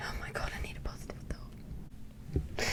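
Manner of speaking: breathy whispered plea to herself. Real, not acted. Whispering to herself, needing a positive thought. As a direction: whisper it like you're begging for relief.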